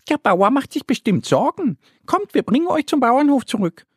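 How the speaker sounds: high, quick little duck voice; bright high duckling, hurried and caring; little duck Annie. Acted. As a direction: chirp it out like a small eager duck.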